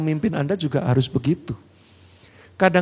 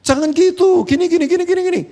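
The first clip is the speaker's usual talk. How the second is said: high, loud mock nagging at his wife; nagging scold; 6 dB louder than usual. Husband nagging his wife, high and loud mock scolding. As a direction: scold her like a fussy husband. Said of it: "saya omelin".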